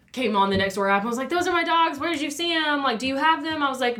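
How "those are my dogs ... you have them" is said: high, urgent questions; imitated frantic owner. Dog owner asking on Nextdoor app, frantic imitation. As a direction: fire off worried questions, pitched well up. Worried owner's messages acted out.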